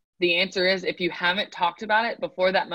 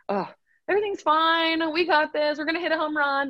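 much higher, bright, overconfident mock cheer; hammed-up pep talk that nobody believes; fake cheery optimism; 10 semitones higher than usual. Mocking a batter's empty hype at the plate, high and cheery. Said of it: "he's like".

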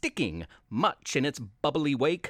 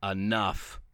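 stern hero; 9 semitones lower, 4 dB quieter than usual. Acted. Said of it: "a voice answered back".

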